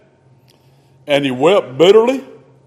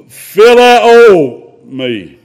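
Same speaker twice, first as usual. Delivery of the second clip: drawn out; 3 semitones higher, 7 dB louder, much slower than usual. Acted. Jesus asks Peter about love.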